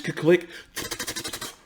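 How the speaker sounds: whispered mock gun clicks; voiced-out gun clicks; gun click noise. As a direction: mimic a gun cocking. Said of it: "coming into school saying".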